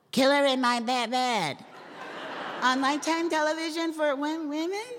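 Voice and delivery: sounding younger. Acted. Voice actor asked to sound younger, high girlish promo read. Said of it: "Can you sound younger".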